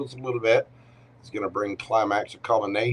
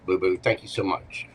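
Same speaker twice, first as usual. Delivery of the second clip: ordinary talk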